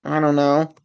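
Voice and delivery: normal talking voice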